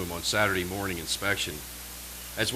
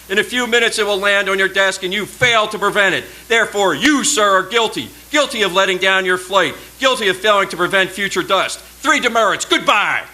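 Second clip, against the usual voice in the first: loud, much higher drill-officer verdict; loud sentencing; 11 semitones higher, 9 dB louder than usual. Retold or imitated. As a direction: pronounce the cadet guilty like a drill officer. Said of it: "thick southern drawl".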